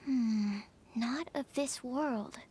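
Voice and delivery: drawn out slowly, lost in thought; slow musing. Acted. The alchemist muses slowly to herself. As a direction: savour each word as you puzzle it out. Repeats phrase while musing.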